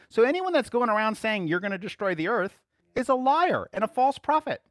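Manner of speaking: high, forceful pulpit declaration; full-force revival preacher; fiery preaching. Acted. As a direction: preach it hard, rising with conviction.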